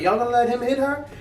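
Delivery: high, indignant lady's voice calling out; Haitian lady. Retold or imitated. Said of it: "She's like".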